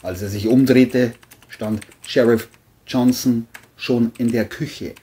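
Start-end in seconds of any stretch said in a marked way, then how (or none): none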